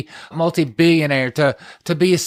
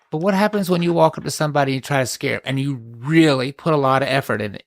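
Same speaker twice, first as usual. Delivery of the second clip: ordinary talk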